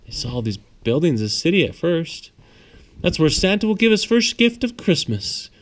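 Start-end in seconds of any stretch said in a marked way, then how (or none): 3.0-5.5 lightly voiced conductor, higher; a hint of the kindly conductor explaining; conductor's voice. A narrator's reading. Conductor telling children about Santa's first gift, a touch higher.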